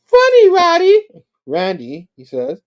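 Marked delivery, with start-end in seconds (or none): 0.1-1.1 very high, loud tipsy squeal; hammed-up drunken falsetto; drunk weird lady. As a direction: squeal it like a drunk woman teasing. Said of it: "she's like".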